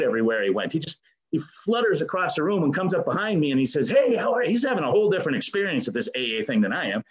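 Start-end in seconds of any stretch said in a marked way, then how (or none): 3.9-4.5 bright, higher, bubbly hello; cheerful friend's greeting. Retold or imitated. Steve floats up behind him with a bright, higher hello. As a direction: greet him like a carefree buddy.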